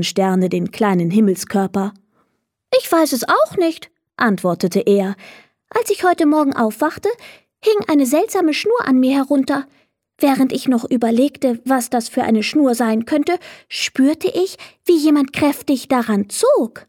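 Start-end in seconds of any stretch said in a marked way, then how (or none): 2.8-3.9 higher, puzzled little star voice; a small star at a loss. Acted. Little star answers the other stars, puzzled and higher. 5.7-16.9 higher little star voice, telling his story; small star recounting a strange morning. Acted. Little star tells the stars about the strange cord, higher voice.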